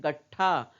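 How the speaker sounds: गट्ठा as 'gat-thaa' with a held ट; half consonant. Shown as a demonstration. Teacher showing how we say it, गट्ठा as 'gat-thaa'. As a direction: stop on the half ट, then release ठा. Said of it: "हम आधा ही बोल रहे हैं".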